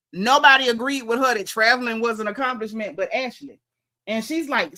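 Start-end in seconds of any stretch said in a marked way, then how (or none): none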